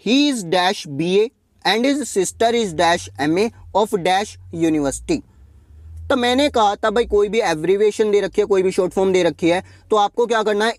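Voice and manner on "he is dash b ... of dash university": very slow, word by word; slow read-out. Shown as a demonstration. Teacher reading question twenty seven aloud, very slow. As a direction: dictate the sentence slowly to the class. Teacher reads the exercise sentence.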